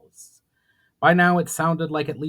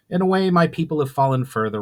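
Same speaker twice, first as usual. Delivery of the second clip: solemn; 5 semitones higher, 6 dB louder, faster than usual. A narrator's reading.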